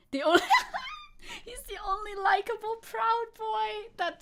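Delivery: high, laughing and near tears; laughing hard. Real, not acted. Streamer cracks up at her own joke, laughing near tears. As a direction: laugh it out almost crying.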